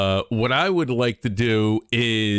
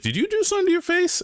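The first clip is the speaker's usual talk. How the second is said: much higher, fast mock girlfriend voice; squeaky quick imitation of his girlfriend; imitating girlfriend; faster than usual. Streamer mimics girlfriend asking about his face. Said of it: "My girlfriend was just like".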